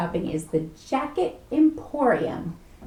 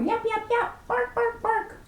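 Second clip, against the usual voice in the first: small dog yaps, pitched way up; high yapping dog; 13 semitones higher than usual. Acted. Reader imitates the dog's yapping, high and quick. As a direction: yap like a tiny excited dog.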